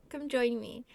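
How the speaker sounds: usual speaking voice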